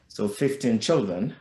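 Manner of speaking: ordinary talk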